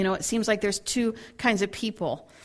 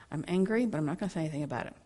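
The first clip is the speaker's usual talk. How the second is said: sullen mutter; 4 semitones lower, 7 dB quieter, much faster than usual. Retold or imitated. Clammed-up person voiced.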